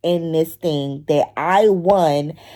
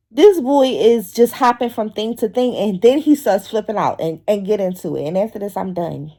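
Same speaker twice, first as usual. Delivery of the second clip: normal talking voice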